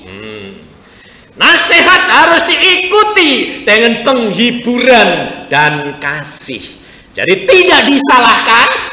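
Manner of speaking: loud, high, impassioned sermon; preaching at full force. Acted. Pastor exhorts the congregation, loud impassioned preaching. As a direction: drive it home to the congregation.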